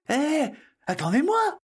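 very high-pitched, anxious call. Acted. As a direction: call after friends who are leaving you behind. Left behind, calling after the others. (appel aigu et inquiet)

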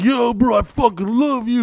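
gushing drunk bro impression; imitating a clingy fan in an alley.

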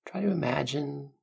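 calm guiding voice, unhurried; guided meditation. Acted.

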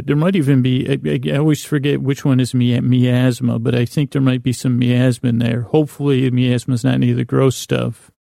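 ordinary talk

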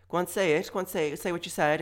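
high, insistent prodding; goading challenge. Acted. Challenger daring a mutterer to repeat it, goading. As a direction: goad them with repeated demands.

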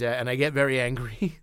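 ordinary talk, nothing marked

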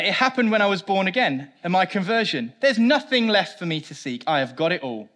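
high, loud, breezy dismissal; a light mock of complacent confidence; smug believer. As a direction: wave it off like it's settled. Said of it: "So we say".

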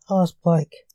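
usual speaking voice

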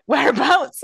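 driver's pushy question. Retold or imitated. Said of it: "he went".

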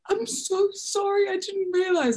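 high gushing mock apology; imitating Alyssa. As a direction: apologise like the sweetest woman. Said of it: "She was just like".

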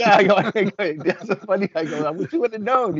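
high, laughing through the words. Real, not acted. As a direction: chuckle while you tell it. Laughing while retelling.

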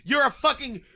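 loud, high hammed-up scolding; mock angry tirade. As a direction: play an agent laying down the law, laughter around. Voices FBI berating the suspect.

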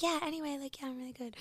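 high, bright put-on cheer over a sick feeling; a fake-happy voice hiding sickness; fake cheerful. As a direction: pretend all is well in a chirpy voice. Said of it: "you change".

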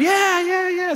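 very high-pitched cheering, no real volume; retold celebratory shouting. Dad re-enacts the family celebrating a passed driving test, high mock shouting.

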